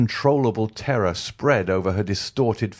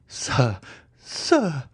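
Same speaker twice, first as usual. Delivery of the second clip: high, desperate cry; terrified cry; 8 semitones higher than usual. A narrator's reading. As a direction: cry out in terror, much higher. Terrified wife cries out.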